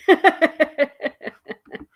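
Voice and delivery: short bright burst of laughter; laughing. Real, not acted. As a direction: laugh out loud. Laughs while browsing videos.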